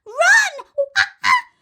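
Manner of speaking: very high, loud monkey shriek; monkey's shrieking shout. A narrator's reading. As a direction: screech the warning at the children. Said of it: "Mugglewump shouted".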